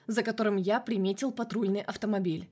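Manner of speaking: plain reading voice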